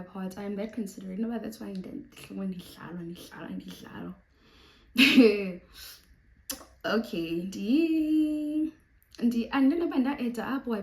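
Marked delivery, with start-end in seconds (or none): 4.9-5.9 big laugh. Real, not acted. Laughs at own rambling.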